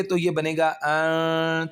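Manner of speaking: अ drawn out slowly; slow pausal reading. Shown as a demonstration. Teacher reading the waqf form slowly. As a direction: draw out the stop slowly. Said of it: "ता की आवाज़ silent हो जाएगी".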